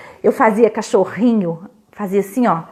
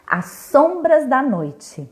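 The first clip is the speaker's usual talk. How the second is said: slow title reading; 3 semitones higher, slower than usual. A narrator's reading.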